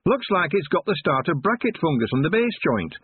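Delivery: high, doubtful dwarf voice; second dwarf. A narrator's reading. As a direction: suggest it like a fussy dwarf. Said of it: "the second dwarf suggested".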